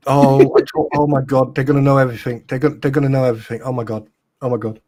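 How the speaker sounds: loud hammed-up panic, crying out; mock panic. Pretending to panic as the document is released, loud mock alarm. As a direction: wail as if all your secrets are exposed.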